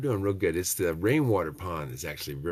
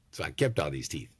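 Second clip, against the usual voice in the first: ordinary talk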